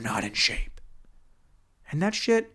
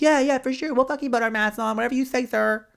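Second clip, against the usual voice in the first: higher, breezy yes-yes-sure voice; mock dismissive agreement; 8 semitones higher than usual. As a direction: brush off the guard with fake compliance. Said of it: "but they're like".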